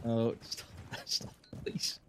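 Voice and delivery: embarrassed laughing. Real, not acted. Embarrassed by a co-caster's teasing.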